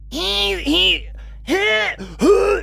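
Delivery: very high, wheezy squeals; high resonant squeaks, testing an impression; high-pitched wheezy noises. Shown as a demonstration. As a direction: squeeze out short high wheezes. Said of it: "He's so high-pitched and wheezy".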